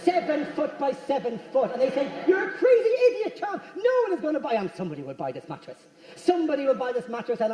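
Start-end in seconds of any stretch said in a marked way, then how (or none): 2.3-4.5 very high-pitched disbelieving outburst; mock incredulous scolding. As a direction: scoff at his plan, pitched way up. Acted naysayers scolding Tom.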